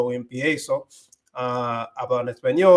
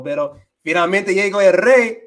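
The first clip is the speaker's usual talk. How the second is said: higher, louder, hearty greeting to a friend; Dominican buddy greeting; 5 semitones higher, 6 dB louder than usual. Retold or imitated. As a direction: hail a Dominican pal the way he talks with his friends. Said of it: "with my Dominican friends".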